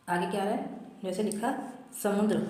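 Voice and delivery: normal talking voice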